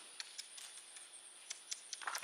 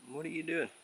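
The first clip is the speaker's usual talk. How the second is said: soft, higher coo to the pet; pet talk; 6 semitones higher, 7 dB quieter, faster than usual. Real, not acted. Owner softly cooing at her pet.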